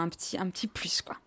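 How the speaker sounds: hushed aside; whispering. Real, not acted. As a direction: drop to a whisper for the tip. Teasing secret ingredient aside.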